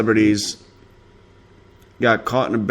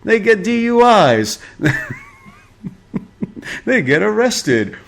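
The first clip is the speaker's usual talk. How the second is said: mock tabloid excitement; 10 semitones higher than usual.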